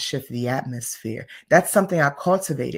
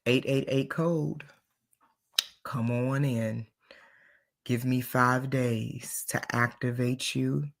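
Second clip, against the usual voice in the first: soft sales pitch; 3 semitones lower, 4 dB quieter than usual. Acted. Pitches her five-day training.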